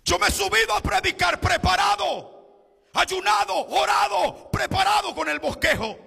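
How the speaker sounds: high, loud sung-out preaching cry; full-force preaching. Acted. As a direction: preach it to the back row, chanting each phrase.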